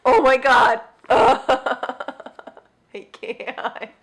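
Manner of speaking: loud laughing oh my God; laugh, unable to cope; giddy laugh through the words. Real, not acted. Laughing at tiny books.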